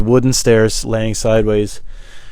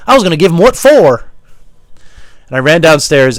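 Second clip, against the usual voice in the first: loud, raised, indignant voice; heated, loud retelling; worked-up storytelling; 4 semitones higher, 5 dB louder than usual. Acted. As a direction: tell it fired up and emphatic. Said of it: "telling the story like this".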